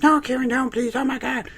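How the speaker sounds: very high mock pleading; high falsetto begging girl. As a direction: beg him, hammed up. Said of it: "And she's like".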